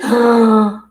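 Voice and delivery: loud, sharp gasp of shock; startled gasp. Real, not acted. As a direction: suck in air loudly, stunned. Startled by reversed card.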